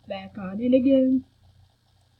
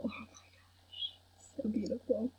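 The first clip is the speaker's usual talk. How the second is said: awestruck whisper; 3 semitones lower, 13 dB quieter than usual. Real, not acted. Player admiring the beautiful scene, awestruck whisper.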